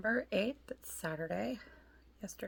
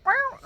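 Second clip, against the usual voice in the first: very high mock cat meow; imitated meow; 8 dB louder than usual. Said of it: "and he went".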